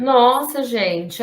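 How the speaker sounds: taken aback. Real, not acted. Presenter to the group after an update, taken aback. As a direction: exclaim it, startled by the news. Startled by update, reacting live.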